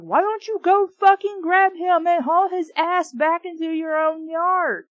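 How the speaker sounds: high, loud angry yelling; exasperated shouting rant; furious outburst. Real, not acted. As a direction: yell at the characters. Reader shouts at the characters.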